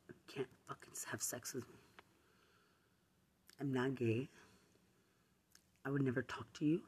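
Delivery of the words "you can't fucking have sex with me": fast, voiceless, disgusted whisper; contemptuous whisper. Real, not acted. Sneering at her accuser, a fast contemptuous whisper. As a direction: spit it out under your breath.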